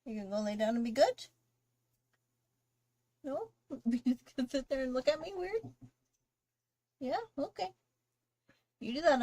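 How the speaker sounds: loud, high-pitched pet talk; sing-song coaxing to a dog. Real, not acted. Scolding her dog sweetly.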